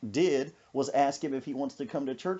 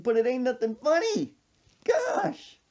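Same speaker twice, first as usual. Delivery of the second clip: laughing protest; 8 semitones higher than usual. Real, not acted.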